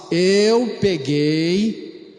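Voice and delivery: put-on slow reluctant child's confession; mumbling child. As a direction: drag the words out like a sheepish kid. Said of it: "Fala assim".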